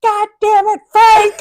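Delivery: high, loud mock yell. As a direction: bellow it across the room in mock anger. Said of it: "the person in Central yells".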